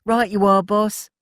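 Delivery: high, loud eager answer; eager reply. A narrator's reading. Walt answering Ted's order, high and loud. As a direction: answer the boss readily.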